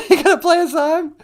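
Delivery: squeaky high puppet voice, loud; high falsetto wife voice. Acted. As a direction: falsetto Michelle puppet greeting.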